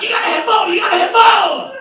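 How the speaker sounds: very high, loud, laughing shout; excited demand. Retold or imitated. Rock star urging the songwriter on, high laughing shouts. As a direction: push him hard with glee.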